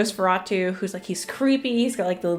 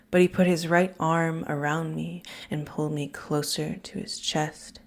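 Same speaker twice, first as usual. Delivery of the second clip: hushed book reading, softer, drawn in; reading aloud; 3 dB quieter than usual. Acted. To viewers, reading Interview with the Vampire aloud, hushed. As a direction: read the passage like a quiet confidence. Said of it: "I'm gonna read this quote".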